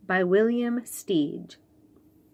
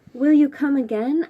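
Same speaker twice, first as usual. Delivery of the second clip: eager question; 6 semitones higher, 7 dB louder than usual. Acted. Child asking friend in story.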